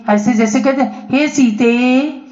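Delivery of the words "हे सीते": हे सीते called slowly, the vowels drawn out to three beats; prolonged call. Shown as a demonstration. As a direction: call हे सीते as if from afar, holding each vowel. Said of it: "ये प्लुत है". (हे सीते को प्लुत स्वर में खींचकर धीरे पुकारना)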